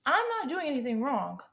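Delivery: insistent, starting high and falling; insistent protest. Acted. Repeats self-defence less squeakily.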